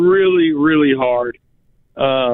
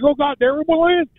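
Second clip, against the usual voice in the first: excited; 9 semitones higher than usual. Retold or imitated. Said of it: "I'm like".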